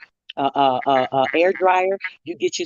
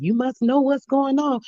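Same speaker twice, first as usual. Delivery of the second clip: lightly voiced old lady, pitched higher; sweet old lady; 8 semitones higher than usual. Old lady remarking on the shopper's cart, lightly imitated. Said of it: "She was a sweet little old lady too".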